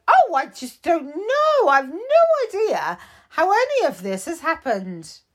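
high, casual excuse-making voice; breezy Shouty Pants. Acted. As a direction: shrug it off, blame the recruits.

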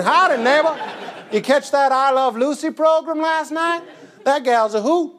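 folksy fifties Southern neighbor; Southern accent. Acted. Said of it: "You're Southern all of a sudden".

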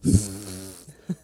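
loud blown raspberry; imitated fart noise. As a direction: blow a long mock fart with the lips. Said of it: "fart noises".

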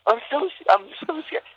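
high, soft fake whimper; fake crying. Kid faking fear to the crew, high soft whimper. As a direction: whimper it like a scared child putting it on. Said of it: "Made myself cry".

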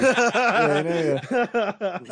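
laughing. Real, not acted. Laughing at podcasting joke.

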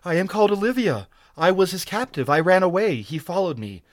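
high-pitched, urgent woman's voice; terrified moaning plea. A narrator's reading. Captive explaining herself to her rescuer, terrified. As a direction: plead high, ending in a loud moaned cry. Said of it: "she moaned in her terror".

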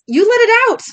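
high, loud outburst; acted high-pitched yell; shouted accusation. A narrator's reading. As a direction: hurl the blame at them loudly. Acted accusation in frightened confrontation.

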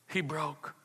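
usual speaking voice

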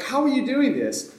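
a light, high how-are-you; amazed hobbits. Retold or imitated. Hobbits freaking out at Tom's trick, high and amazed.

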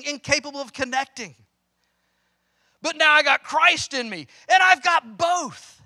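high, loud preaching to his parents; impassioned plea. Acted. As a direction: plead with them from the pulpit. Preacher voicing words to his parents.

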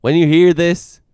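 loud, slow, high-pitched call-out; playful shouted dare to a fight; mock challenge. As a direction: call him out with hammed-up bravado. Mock challenge addressed to Alex Jones.

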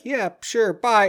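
very high, chirpy retold goodbye; mock-casual exit line; breezy brush-off. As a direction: toss off a quick high-pitched farewell. Retold hasty brush-off before vanishing.

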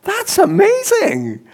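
high, slow mock Glaswegian lady, in awe; a wondering, weak Glaswegian accent, pitched high; amazed Glaswegian lady. Glaswegian ladies after the prayer, in a high, awed mock Glaswegian accent. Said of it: "they were just like".

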